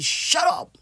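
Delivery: teacher shows the rude way to tell someone off, harsh; harsh angry shut up. Shown as a demonstration. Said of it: "c'est pas bon".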